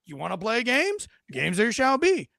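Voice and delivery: playful hammed-up menace; mock attitude. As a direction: tease him like a cop who just found a reason. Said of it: "this guy gets attitude".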